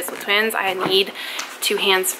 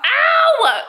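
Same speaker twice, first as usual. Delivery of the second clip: pained yelp; 12 semitones higher, 4 dB louder than usual. Real, not acted. Baby pulling her hair.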